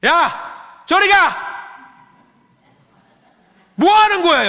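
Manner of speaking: loud angry yell; harsh scolding shout; shouting at kids. Pretend. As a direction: yell at the smoking kids to get lost. Pastor voices scolding adult.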